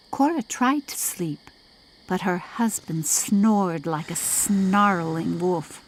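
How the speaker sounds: ordinary delivery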